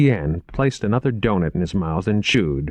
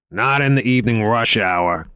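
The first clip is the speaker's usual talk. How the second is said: mildly raised, higher retort; called out; 4 semitones higher, 4 dB louder than usual. A narrator's reading. D'Augusta overruling the driver at rush hour, called out. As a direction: call it to the driver. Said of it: "called Augusta".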